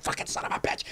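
hissed under the breath, barely voiced; angry whisper in a movie theater; whispered curse. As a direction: whisper the swear so the row can't hear. Cursing quietly in the theater.

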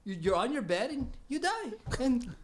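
high, laughing mock of a casual friend. Retelling to the host how the friend shrugged it off, laughing. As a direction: giggle through his shrugging words.

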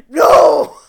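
a loud, high "No!" at the screen; outraged shout. Real, not acted. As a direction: shout it out in protest. Outraged at the character.

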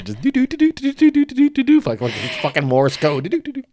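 high staccato "do, do, do" like button beeps; imitated beeping. Mocking the boss mashing his insulin button, high beep noises. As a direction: tap out the pump beeps with the voice, high and quick.

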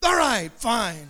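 slow, drawn-out giving in; grudging surrender. Retold or imitated. Preacher imitating the man giving in, grudging drawl. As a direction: imitate a man reluctantly backing down.

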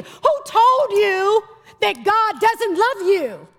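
preacher building to a cry; building litany. Real, not acted. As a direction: press each who harder.

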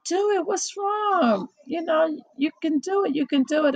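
encouraging friends. Retold or imitated. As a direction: urge her on like a cheerleader. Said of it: "people's like".

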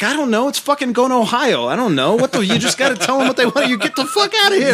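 high, laughing mock bravado; laughing brush-off. Telling the girlfriend how to handle New Yorkers, high laughing. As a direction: tell them anything and laugh. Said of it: "I'm like".

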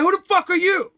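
loud, high, suspicious challenge through the door; a paranoid dealer yelling at a stranger at his door; hostile yell. Retold or imitated. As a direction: bark it at the stranger knocking. Stranger yells through hotel door.

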